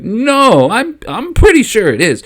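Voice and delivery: unsure but pushing the point anyway; nervous. Acted. Obi-Wan to Palpatine, nervously insisting. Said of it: "nervously looking at Qui-Gon and Dooku".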